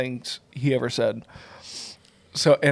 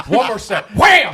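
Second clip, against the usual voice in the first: high, loud mock collision; mock crash; 8 semitones higher, 4 dB louder than usual. Teasing a friend with a mock crash, high and loud. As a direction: act out walking into glass.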